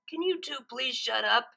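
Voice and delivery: a lightly voiced grumpy dragon's complaint; a mild mock yell from a sleepy dragon; angry yell. A narrator's reading. Spike woken up, complaining at the two ponies, mildly angry. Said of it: "yelled an angry purple dragon".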